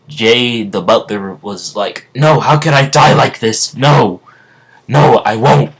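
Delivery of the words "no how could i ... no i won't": loud, raised cry of refusal; hammed-up villain refusing death; Jay's desperate protest. Butler villain facing death at sunrise, loud desperate protest.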